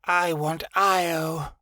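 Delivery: moaned.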